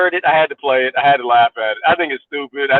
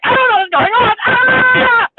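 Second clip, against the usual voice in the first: much higher, ending in a mock shriek; mock clueless panic; slower than usual. Mocking confused people for his guests, panicky shriek. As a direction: play a confused fool losing it. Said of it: "If you're stupid".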